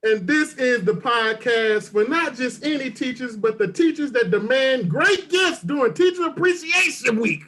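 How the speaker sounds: punchy, sing-out podcast host delivery; show intro. Acted. Host pitches the podcast for teachers, hyped intro. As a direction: sell the show's tagline with big emphasis.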